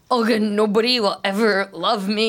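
ordinary talk, nothing marked

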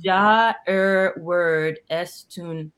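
very slow, word by word; deliberate pronunciation attempt; careful foreign reading. Real, not acted. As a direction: sound out each German word. Reading out typed German reply.

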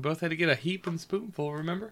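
breathy hushed whisper. Real, not acted. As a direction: hush it under your breath. Whispered reaction.

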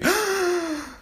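a sharp high gasp of surprise; shocked gasp. Retold or imitated. Telling listeners how she realised she missed a week, sharp gasp. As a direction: gasp like you just caught your own mistake. Realising episode twelve days late.

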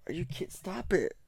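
high, sharp scolding at the cat. Real, not acted. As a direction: snap at a pet chewing the cord. Scolding the cat chewing cords.

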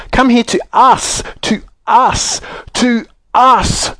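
high, insistent repeated call; despairing shout. A narrator's reading. Joe correcting the saucepan man, shouting in despair. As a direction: shout it desperately, hammering the last word. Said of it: "shouted Joe in despair".

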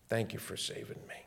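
breathless whisper of thanks; whispered prayer. Retold or imitated. Sinner on his knees thanking the Lord, whispered.

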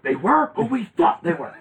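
a hint of a fuming coach; mock angry coach. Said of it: "he's like".

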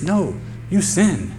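slow, weighted rebuke; slow blunt correction. Real, not acted. Preacher correcting excuse-makers, slow blunt rebuke. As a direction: land each word.

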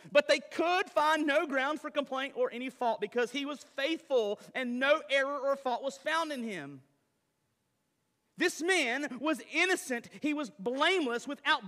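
loud, high preaching voice; forceful preaching. Acted.

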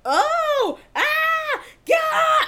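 very high, loud freak-out cries; high-pitched, loud outbursts; bugging out. Retold or imitated. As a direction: shriek as if bugging out over a kiss. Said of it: "I was bugging out at this kiss".

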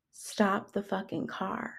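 hushed, slow cold command; menacing roommate order. Retold or imitated. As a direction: imitate a threat in a very low volume. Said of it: "my roommate has the audacity to say".

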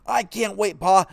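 put-on high, eager boy's voice; bursting with excitement; boy running in to tell Pa; excited. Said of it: "He felt so excited".

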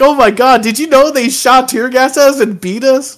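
high, loud mock-panicked retelling; hammed-up terrified American woman. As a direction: squeal it like a frightened tourist. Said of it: "They're like".

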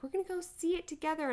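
high, quick gushing excitement; excited moms. Retold or imitated. Moms planning a girls' night, gushing excitedly. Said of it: "all of us moms were like".

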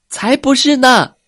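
shouting protest. A narrator's reading. Four baby ankylosaurs yelling at the bragging brother, loudly. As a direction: shout him down together. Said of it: "另外四只甲龙宝宝大嚷道".

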